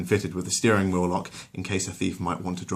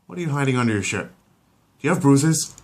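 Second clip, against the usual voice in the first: higher, louder, quick worried dad; alarmed dad; 7 semitones higher, 6 dB louder than usual. Acted. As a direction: demand answers in a panic. Peter fears for his son.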